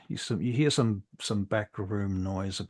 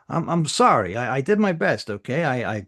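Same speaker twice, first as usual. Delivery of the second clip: defensive official; 6 semitones higher, 9 dB louder, faster than usual. Acted. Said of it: "She's like".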